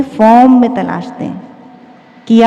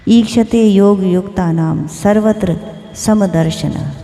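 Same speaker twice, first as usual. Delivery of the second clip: shloka recitation; 3 semitones lower than usual. Acted. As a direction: intone the Sanskrit verse steadily. Recites Gita verse before the discourse. (श्लोक का धीमा पाठ)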